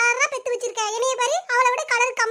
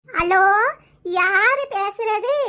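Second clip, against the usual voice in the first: put-on old grandmother; much slower than usual. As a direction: drag each word like a confused grandma. Grandmother answering unknown phone call.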